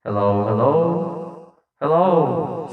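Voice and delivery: long booming echo on the mic; heavy echo. Shown as a demonstration. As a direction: say it into a full reverb. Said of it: "echo all the way".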